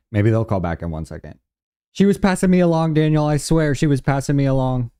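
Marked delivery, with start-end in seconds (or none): 1.9-4.9 excited. Real, not acted. Excited after first call attempt.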